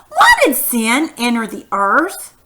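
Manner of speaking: slow question rising on "earth"; question intonation. Shown as a demonstration. Teacher contrasting sentence types for pupils, questioning rise on "earth". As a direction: ask it unhurriedly, lifting "earth". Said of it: "difference in my voice and how I read the sentences".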